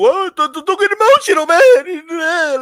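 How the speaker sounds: high, loud, hammed-up crybaby voice; mocking whiny complainer. Streamer mimicking people who object to emotion, in a high mocking whine. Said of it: "What do people do?".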